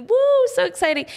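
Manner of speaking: high, whooping celebration; joyful excitement. Acted. Celebrating a new life at a birth, joyfully excited. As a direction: let out a high woo of delight. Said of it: "how joyful we are when there's a new life".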